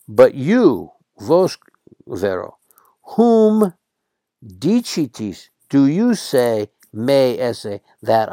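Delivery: a teacher acting Jesus' pointed question; emphatic question. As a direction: lean hard on "vos" and "whom" as if demanding an answer.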